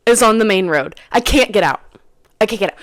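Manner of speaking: usual speaking voice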